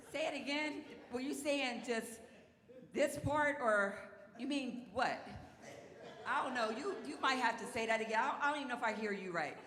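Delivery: high, loud mock bewilderment; hammed-up failure to understand; feigned confusion. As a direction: play dumb, stalling at full voice. Acts out pretending to mishear God.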